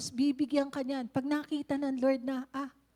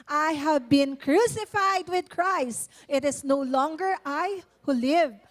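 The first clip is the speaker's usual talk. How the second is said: solemn scripture reading; 6 dB louder, slower than usual. Shown as a demonstration. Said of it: "okay, let's all read this".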